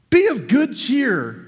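high, loud, slow and bright; hearty call. Retold or imitated. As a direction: call out the cheer boldly over the storm. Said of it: "Jesus comes to the disciples".

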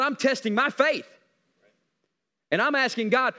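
fervent, insistent pulpit prayer; driving preacher's delivery, full of conviction; passionate preaching. Acted. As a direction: press each phrase home to God.

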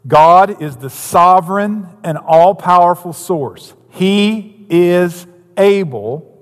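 slow, weighty preacher's declaration; preaching at full force. Acted. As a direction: proclaim it slowly to the congregation.

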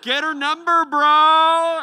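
loud, high teasing shout; friend's heckle. Retold or imitated. Buddy in the back heckling him, high and loud. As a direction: holler it across the room to embarrass him.

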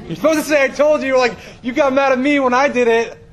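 loud, high-pitched mock outburst; exasperated protest. Acts out his protest to wife.

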